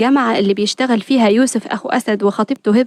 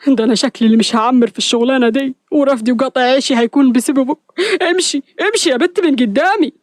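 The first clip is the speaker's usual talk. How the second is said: exasperated. A narrator's reading. Hassan blames Hour for his sacking, exasperated. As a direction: throw the complaint back, then order off. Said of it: "حسان بغيظ وهو حاسس ببوادر الشلل".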